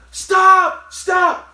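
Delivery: high, loud cries of stop; panicked yelling. Retold or imitated. Robbed woman screaming at the thief, loud and high. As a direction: yell after the thief as he runs off.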